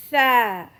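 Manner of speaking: sa with the tongue between the teeth, like English th; Arabic sa. Shown as a demonstration. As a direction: say sa as tha. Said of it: "then say".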